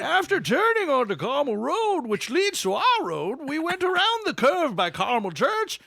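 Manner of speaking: put-on high, loud old prospector voice; cranky old-timer, high and loud. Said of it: "I went from the old prospector".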